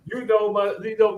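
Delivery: ordinary talk, nothing marked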